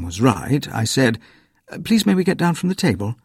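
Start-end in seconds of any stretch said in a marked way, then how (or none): 1.8-3.2 put-on higher, fast eager child; polite child. A narrator's reading. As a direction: ask quickly and politely.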